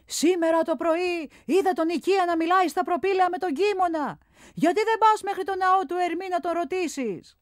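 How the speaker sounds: high, friendly voiced character; a lively craftsman's voice; Phidias the sculptor. A narrator's reading. Phidias advises the boy in a high, lively voice.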